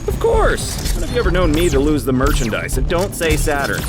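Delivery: high-pitched, quick, theatrical indignation; indignant boast. Acted. Ghost defending a record to a comrade, indignant boast. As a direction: protest grandly, voice leaping up.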